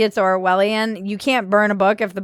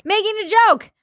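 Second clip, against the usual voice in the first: playful echo; 10 semitones higher, faster than usual. Acted. Playful echo of co-host's phrase.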